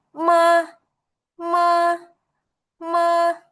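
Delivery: MA held high and level. Shown as a demonstration. Teacher showing Mandarin tone one, MA high and level. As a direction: sustain MA on one steady high pitch. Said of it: "dia tuh tone-nya tinggi".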